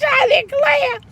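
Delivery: high giggly I declare; amused mock exclamation; laughing I declare. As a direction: squeal it through a laugh. Catchphrase after laughing.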